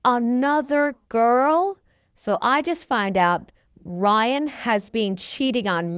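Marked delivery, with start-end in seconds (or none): 0.0-1.8 put-on slow, drawn-out GIRL rising in disbelief; shocked echo. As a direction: repeat "girl" slowly, incredulous. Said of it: "And I was shocked".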